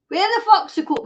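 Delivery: mock-outraged demand; rude version. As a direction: ask it the rude way, sweary and pushy. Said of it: "Well, don't say".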